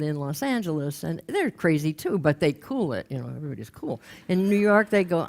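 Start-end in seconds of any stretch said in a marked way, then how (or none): none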